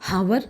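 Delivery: "Hover" with the H sounded; wrong pronunciation. Shown as a demonstration. Teacher warns students off a form, "Hover" with sounded H. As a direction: start "Hover" on a breathed h. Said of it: "എന്ന് പറയരുത്".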